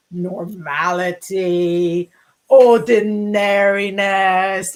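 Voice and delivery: drawn out with distaste; mock disdain. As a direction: sneer at the word slowly. Mock disdain for normal life.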